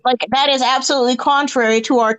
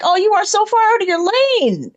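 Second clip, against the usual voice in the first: incredulous scoff; 9 semitones higher, faster than usual. Retold or imitated. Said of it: "I'm like".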